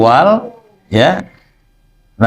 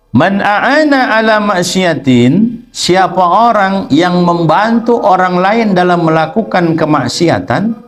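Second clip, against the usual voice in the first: higher, slow phrase-by-phrase reading; kitab reading; 5 semitones higher, slower than usual. Acted. Teacher translating sacred text for students, slow measured recitation.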